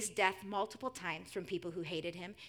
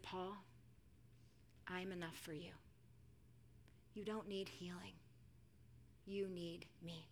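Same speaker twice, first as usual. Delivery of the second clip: very soft, low comfort; hushed divine assurance; tender reassurance; 3 semitones lower, 16 dB quieter than usual. Acted. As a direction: answer a plea gently, with long pauses. God tenderly answering Paul's plea.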